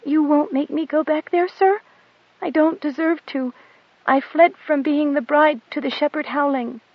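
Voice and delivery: put-on high, humble little girl begging forgiveness; meek pleading girl. A narrator's reading. Goat Dung begs the pilot's pardon in a high meek voice. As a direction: grovel before the captain of angels.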